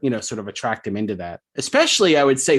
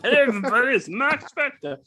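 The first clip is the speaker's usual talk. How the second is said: much higher put-on voice, laughing; goofy Mark Spector voice; 12 semitones higher than usual. Host tops the joke with a high laughing Mark Spector greeting. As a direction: overdo a cheery silly intro. Cohosts riff on silly Mark voice.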